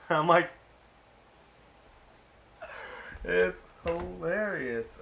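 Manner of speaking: real laugh spilling into the words; laughing through words. Real, not acted. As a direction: laugh it out, then drawl slowly. Laughing at the episode.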